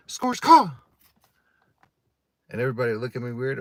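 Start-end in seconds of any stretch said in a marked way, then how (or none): 0.1-0.7 high loud screeching caw; crow caw. Retold or imitated. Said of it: "I'd be like".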